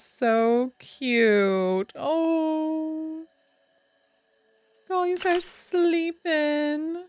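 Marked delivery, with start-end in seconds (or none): 2.0-7.0 cooing. Real, not acted. Cooing at sleeping followers.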